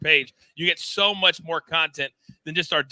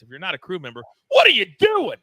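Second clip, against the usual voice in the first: crew slogan shout; 3 semitones higher, 7 dB louder than usual. Shown as a demonstration. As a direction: build up to a big shouted what are you doing. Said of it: "we like to say it like this".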